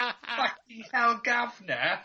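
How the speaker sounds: put-on slowed, hammy London gent accent; jokey London gent accent aimed at the American host; mock London gent guv'nor. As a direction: drawl it like a foggy old London gent. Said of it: "like I'm a fucking London English gent".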